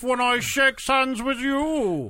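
high, slow Cockney villain drawl; Cockney Bane voice. Pretend. Riffing on Tom Hardy, slow hammed-up Cockney Bane. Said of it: "Cockney and Bane sound like".

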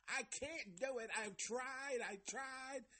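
high, mocking childish voice; little-girl whine. Reviewer mocking Mike acting like a child, little-girl whine. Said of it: "a fucking little girl, a child".

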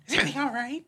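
hammed-up outrage at a friend; mock fury, high and laughing. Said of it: "I'm like".

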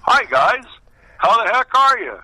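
loud, high, overly cheerful hello; mock-bright hello to old friends; cheery greeting. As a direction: greet the guys like you're thrilled to be there. Cheery greeting to new teammates.

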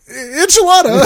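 high, loud panicked blurt; Amy panics and shouts her order. Retold or imitated. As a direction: blurt it out under pressure. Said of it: "and Amy's like".

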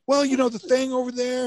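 high mock explainer voice; mimicked friend explaining. Storyteller imitating his friend explaining the damage, high-pitched.

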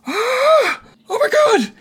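very high, loud awestruck squeal; high-pitched starstruck cry. Acted. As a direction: gasp and shriek in amazement. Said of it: "his excitement level j-, is just raised".

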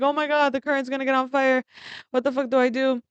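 high-pitched re-enacted alarm; retold panic. Telling how the fire went bigger, re-enacted panic. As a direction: relive the panic, pitch jumping up.

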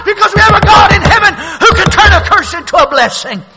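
building to a high, loud shouted peak; fiery preaching climax. Acted. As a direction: rise to full force on the blessing. Sermon climax, preaching at full force.

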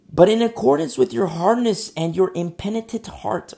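thundering scripture. Real, not acted. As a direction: proclaim the verse with force. Preacher thunders scripture verse.

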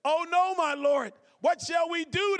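high, loud cry of alarm from a frightened servant; panicked servant. Acted. Servant panics seeing the army.

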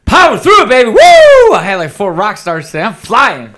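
high, loud shout with a whoop; over-the-top burst of energy; powering through, hyped up. Pretend. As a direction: whoop it up like you're flying on energy drinks. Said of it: "use that and power through this one".

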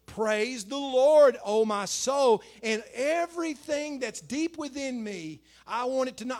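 loud, very slow proclamation, riding high; exhorting praise. Acted. Preacher voicing the psalmist encouraging his soul, exhorting. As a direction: belt out the praise, drawing out each word.

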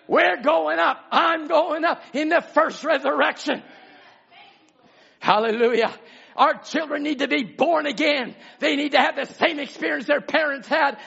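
high, loud, impassioned preacher's shout; full-force revival sermon, pitched high and driven hard; fiery preaching. Real, not acted. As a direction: thunder it out to the congregation.

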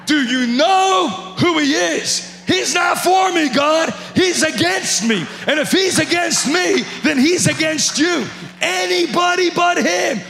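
loud, high, slow pleading shout at God; desperate shout of protest to God; shouted plea. Retold or imitated.

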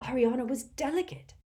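put-on high, pleading old man; desperate. A narrator's reading. Doge defending Ariana, desperate and high. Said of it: "said Doge desperately".

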